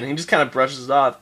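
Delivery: normal talking voice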